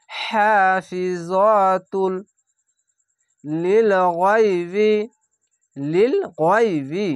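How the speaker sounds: slow, drawn-out chanted recitation; Quranic recitation. Shown as a demonstration. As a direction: recite each word slowly with tajweed. Recited demo.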